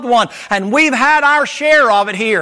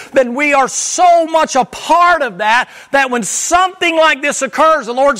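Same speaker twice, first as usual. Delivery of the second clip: higher, slow and intense, every "so" leaned on; preaching climax; 5 semitones higher, slower than usual. Acted. Preacher warning the congregation, higher, slow, building intensity. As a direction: build the list slowly, pressing each "we're so".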